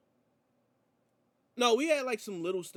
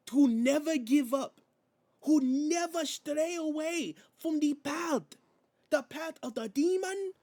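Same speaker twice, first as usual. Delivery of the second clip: put-on loud, high Spanish-accented champion's promo; Penta promo impression; 12 semitones higher, 7 dB louder than usual. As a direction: declaim it phrase by phrase in a mock Spanish accent. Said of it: "Like, he was just talking".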